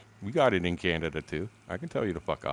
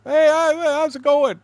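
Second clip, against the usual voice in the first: high, loud, chirpy hello; mock friendly greeting; 8 dB louder, slower than usual. [name] cutting into the argument, high and bright and loud. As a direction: butt in all cheery to smooth it over.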